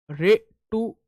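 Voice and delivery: ऋतु रस stretched out very slowly; slow memorizing chant; very slow drill. Shown as a demonstration. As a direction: drag out ऋतु रस so it sticks. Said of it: "इसको याद कर लो".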